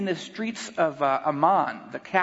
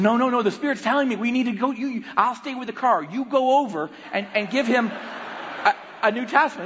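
higher-pitched, pressing instructions; insistent; 5 semitones higher than usual. Retold or imitated. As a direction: imitate a wife overruling her husband. Wife insists, retold.

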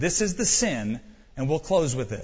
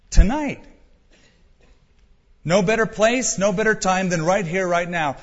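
urgent appeal; 5 semitones higher, 6 dB louder than usual. Real, not acted. Urgent altar call to the congregation.

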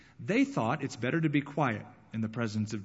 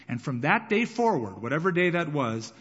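normal talking voice

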